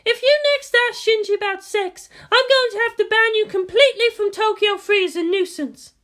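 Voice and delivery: high-voiced fierce commander with a half grin; Misato, fierce. A narrator's reading. Misato threatening the reporter, high and fierce. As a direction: warn her off with a fierce grin. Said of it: "with a fierce half grin on her face".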